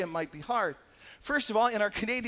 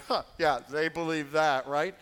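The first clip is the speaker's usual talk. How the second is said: scoffing chide; 4 semitones lower than usual. Retold or imitated. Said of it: "we chide each other going".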